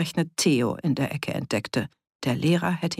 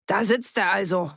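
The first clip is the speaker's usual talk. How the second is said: higher-pitched, mildly accusing call; 4 semitones higher than usual. A narrator's reading. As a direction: announce him accusingly to the room. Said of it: "rief er in die Runde". (anklagend in die Runde rufen)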